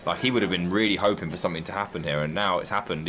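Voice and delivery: normal talking voice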